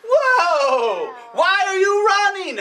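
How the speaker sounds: a second rabbit startled and curious; surprised rabbit calling out. Acted. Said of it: "the third rabbit said".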